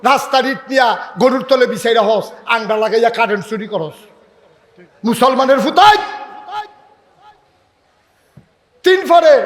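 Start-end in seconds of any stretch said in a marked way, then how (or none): 5.1-9.3 high, loud shouting sermon warning; loud, high-pitched preaching at full force; fiery preaching. Acted. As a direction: thunder the warning at the youths.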